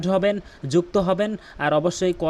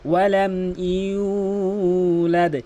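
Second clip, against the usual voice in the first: slow chanted recitation; Quran recitation; 3 semitones higher, much slower than usual. Shown as a demonstration. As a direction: recite it slowly, tajweed style. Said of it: "এখানে মারাত্মক লেভেলের ভুল".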